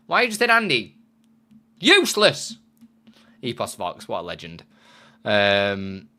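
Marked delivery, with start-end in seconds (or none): 0.1-2.6 high loud teasing shout; mock yelling. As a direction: scold chat in jest.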